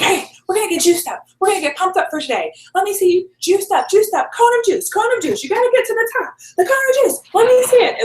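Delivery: high, bubbly pep-rally voice; Jackie hyping. Retold or imitated. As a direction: cheer everyone to the cone of juice. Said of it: "She's like".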